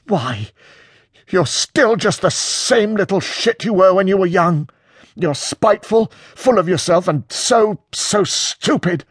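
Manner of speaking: high-pitched spitting contempt; almost spitting. A narrator's reading. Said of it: "Peter is almost spitting".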